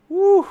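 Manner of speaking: high, loud whoop of delight; excited whoop. Real, not acted. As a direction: cheer at the design. Cheering at demigod design.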